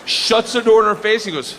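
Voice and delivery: ordinary talk, nothing marked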